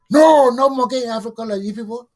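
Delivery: replaying his shocked reaction; retold outburst. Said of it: "I said".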